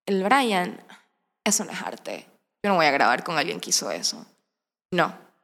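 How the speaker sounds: low, soft, dismissive verdict; disdainful refusal. Retold or imitated. Actress recalling refusing to record with Brian, disdainful. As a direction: turn it down with quiet snobbery, pausing between phrases.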